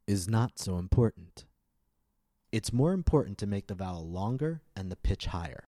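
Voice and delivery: normal talking voice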